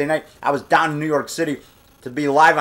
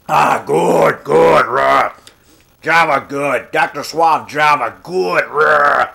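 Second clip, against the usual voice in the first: drawn-out monster speech with growls; slow, grunting creature delight; Frankenstein; much slower than usual. Pretend. Monster approving his coffee, crudely pleased.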